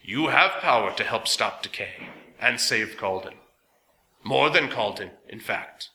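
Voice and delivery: lightly metallic machine voice. A narrator's reading. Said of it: "in the same strange metallic voice".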